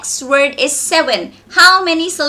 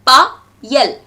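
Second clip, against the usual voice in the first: PA, YEL as two beats, pause between; broken into syllables; 4 dB louder than usual. Shown as a demonstration. Teacher repeats the two syllables for students, pa - yel split apart. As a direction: say pa, then yel, separately. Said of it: "so two syllables".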